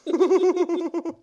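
high muffled laugh through marshmallows; stuffed-mouth laugh. Real, not acted. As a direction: laugh with cheeks full.

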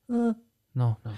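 mock robot mother; robotic. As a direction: imitate someone acting like a robot. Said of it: "she was just totally like a robot".

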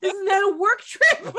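amused, incredulous objection; laughing. Real, not acted. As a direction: say it through real laughter. Cracking up over work-trip story.

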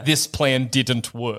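low, slow deadpan villain; slow, deep admission of failure; mock dejected Ursula. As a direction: sink low and slow as a defeated sea witch. Voicing Ursula admitting failure.

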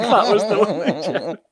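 laughing Tim Curry. Retold or imitated. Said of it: "He, he laughs as well at the end of the take".

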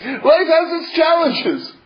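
half-laughing, half-crying; laughing while talking. Real, not acted. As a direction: laugh through the words. Laughing-crying about life.

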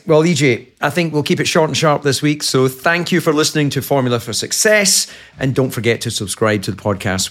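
upbeat announcer sign-off; bright host wrap-up read; podcast outro voice. Acted.

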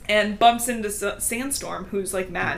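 usual speaking voice

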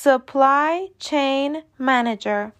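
supply chain manager said very slowly, with supply as "suh-PLAI"; slow model reading with a schwa in supply; slow careful demo. Shown as a demonstration. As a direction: repeat it slowly for learners.

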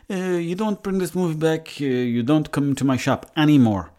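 put-on Italian-Mayo accent, starting high; Italian Mayo twang. Shopkeeper banning him over unreturned movie, Italian Mayo twang. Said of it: "a bit of a Italian Mayo twang".

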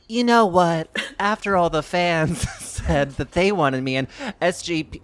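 high, loud, gushing mock diva voice; Kim Cattrall impression. Podcast host mocking an actress returning to the show, loud high gushing. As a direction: ham up a starlet caving to fan demands. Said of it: "would Kim Cattrall be like".

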